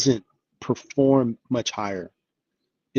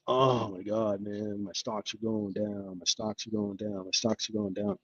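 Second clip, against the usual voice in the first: miserable investor; 5 semitones lower, 11 dB quieter than usual. Retold or imitated. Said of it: "even though we're all miserable 'cause".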